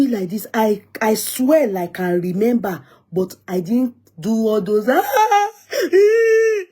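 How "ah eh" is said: loud, very high imitated wail; mock crying wail. Retelling daddy's slap, loud high mock crying. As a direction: burst into a piercing pretend cry. Acting out reaction to father's slap.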